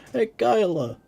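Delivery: very loud, high hammed-up New York holler; New York accent. Streamer calling Kyla to take a look, shouted New York accent. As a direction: yell it out like a cartoon New Yorker. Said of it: "sorry, a New York accent".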